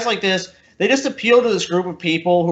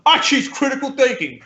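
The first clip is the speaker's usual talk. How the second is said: pitched up prim mock; mock earnest guest; 6 semitones higher than usual. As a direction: sneer the boast. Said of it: "like the giraffe we had on before".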